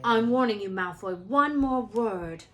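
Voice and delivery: angry warning. A narrator's reading. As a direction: lay down a hard threat. Ron threatening Malfoy.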